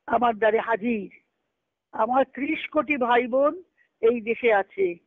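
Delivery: usual speaking voice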